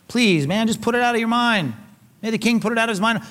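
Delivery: acted, imploring appeal; begging plea. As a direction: beg the king to let it go. Acting Shimei begging the king.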